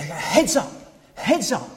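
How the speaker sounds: mock the cox shout, laughing. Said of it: "all coxes speak like that".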